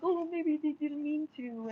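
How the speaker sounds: slow, whiny mock voice of the excusers. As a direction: sneer out the lame excuse. Mocking other people's excuse.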